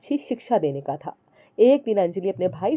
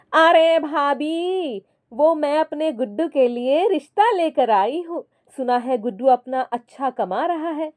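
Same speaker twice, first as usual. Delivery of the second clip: sister-in-law voice; 6 semitones higher than usual. Acted. Sister-in-law on the phone brushing off her brother's wife, sugary. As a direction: coax her brother's wife while dodging help.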